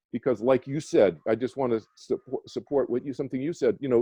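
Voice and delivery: ordinary talk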